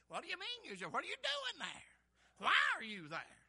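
high, swooping pointed questions; incredulous challenge. Acted. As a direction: challenge a lame excuse in disbelief. Preacher challenges an excuse-maker.

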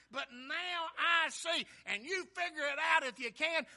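loud, rising preacher's cadence; preaching at full force. Acted. Preacher to his congregation, preaching at full force. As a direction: preach it loud, building to the healed man's cry.

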